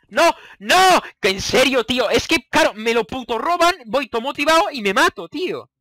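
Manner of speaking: high, loud anguished protest; frustrated outcry. Real, not acted. Gamer dying right after grabbing the wool, loud frustrated outcry. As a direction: wail at losing it all.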